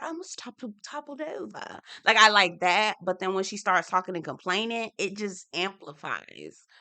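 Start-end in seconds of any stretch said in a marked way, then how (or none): 0.0-1.8 high, soft mock British accent; light imitation of a British accent, high and soft. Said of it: "when she says certain things, like".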